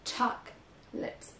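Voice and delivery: chocolate with the weight on choc, slowly; slow demo leaning on choc; stress first syllable. Shown as a demonstration. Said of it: "la première syllabe est accentuée".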